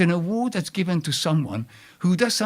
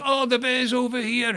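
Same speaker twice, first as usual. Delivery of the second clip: retold shout; 8 semitones higher than usual. Planters pass word along the path, a mock shout. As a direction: imitate a warning call passed along. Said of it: "then the shout along the, the path goes".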